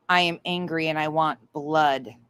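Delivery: usual speaking voice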